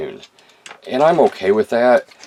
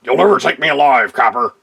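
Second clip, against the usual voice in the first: defiant villain; 4 semitones higher, 5 dB louder than usual. Acted. Toy skit, cornered villain.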